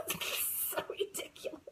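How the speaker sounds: high laugh dissolving into voiceless words; teary laughing. Real, not acted. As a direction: laugh helplessly at your own tears. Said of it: "I'm getting teary".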